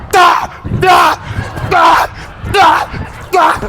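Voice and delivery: loud, high shouted da-da-da hits; mock smell blast. Telling viewers how the vomit smell hit, loud high blasts. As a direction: belt it like an alarm going off. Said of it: "the smell was like".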